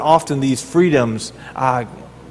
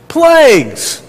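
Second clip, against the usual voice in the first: much higher and louder single word; emphatic exclaim; 10 semitones higher, 4 dB louder than usual. Acted. Preacher to congregation, naming the ten plagues loudly. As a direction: throw out Plagues like a revelation. Preacher answers his own question.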